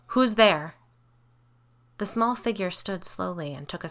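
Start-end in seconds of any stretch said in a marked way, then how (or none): 0.1-0.7 higher, louder challenge; calling out. A narrator's reading. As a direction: call out to whoever is hiding in the dark. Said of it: "calling out".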